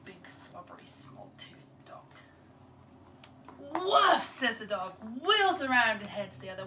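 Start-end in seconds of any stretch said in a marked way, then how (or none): 0.1-2.1 slow, whispered grumble; hushed muttering to oneself; mumbled under breath. A narrator's reading. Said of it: "mumbles under her breath". 3.6-4.2 loud, high barked woof; sudden storyteller's dog bark; dog's woof. A narrator's reading. Offended dog barks in the story.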